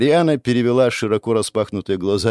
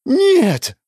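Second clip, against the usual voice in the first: shrieking denial; 5 semitones higher, 3 dB louder, slower than usual. Acted. Ariana screams denial.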